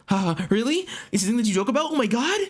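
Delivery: high put-on excited voice; hammed-up eager reaction; giddy amazement. Acts out his giddy reply.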